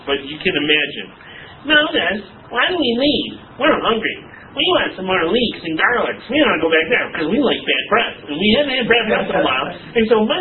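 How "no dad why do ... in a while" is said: put-on higher-pitched, sing-song child's complaint; whining kids. Hungry Israelite children complaining to their dad, mock whining.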